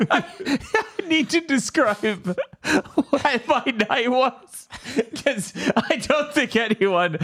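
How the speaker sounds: giggling as you start the tale; laughing. Real, not acted. Laughing while starting story.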